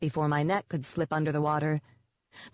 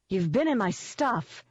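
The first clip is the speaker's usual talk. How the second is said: higher, sharp accusation; accusing; 5 semitones higher than usual. A narrator's reading. Ivy catching Jenks snooping, accusing. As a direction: accuse him outright. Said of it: "she accused".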